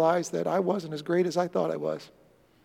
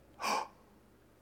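sharp gasp; 15 dB quieter than usual. Acted. Light bulb moment.

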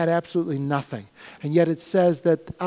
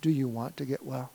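softer, lower, gentle asking; quiet question; 4 semitones lower, 9 dB quieter than usual. Acted. Pastor quietly asking the church again, soft. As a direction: ask it gently after the prayer.

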